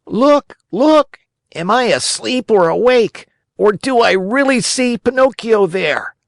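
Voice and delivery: high, loud cries of astonished delight; wild yell. A narrator's reading. Harlequin yelling wildly at the audience, high and excited. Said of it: "yelling wildly at the same time".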